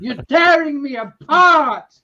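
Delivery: slow, high, loud movie-line imitation; hammed-up anguished shout. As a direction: stretch it out and wail it loudly. Acts out famous film line.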